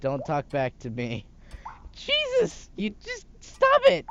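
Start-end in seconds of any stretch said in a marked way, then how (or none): 1.9-4.0 very high frantic shriek. Acted. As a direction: shriek it, losing control. Son loses temper.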